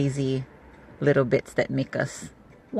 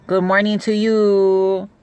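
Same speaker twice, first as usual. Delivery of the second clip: higher, slow drawn-out greeting; sing-song greeting; 6 semitones higher, 4 dB louder, slower than usual. Real, not acted. Auntie greeting fellow Hawaiians in sing-song. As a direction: sing the good morning lightly.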